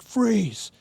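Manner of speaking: sharp high police shout; cop yelling freeze. Retold or imitated. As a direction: bark it like a cop on the chase. Cops shouting at fleeing speaker.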